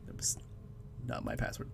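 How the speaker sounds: whispered aside, low; whispering. Real, not acted. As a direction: whisper it like a secret confession. Joking denial after laughing.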